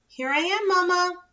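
high, loud happy call; excited call. Acted. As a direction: call out with relief. Finding mother, calling out.

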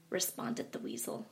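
ordinary delivery, nothing marked

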